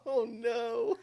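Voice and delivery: words squeezed out through laughter; can't stop giggling; laughing. Real, not acted. Laughing fit during intro.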